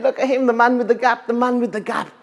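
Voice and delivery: high, jeering taunt of a laughing crowd; mocking crowd. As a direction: taunt him like a teasing mob. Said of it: "started laughing and saying".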